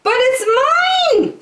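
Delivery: very high shrieking bird claim; a greedy bird demanding; screaming Maisie. Acted. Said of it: "screamed the bird".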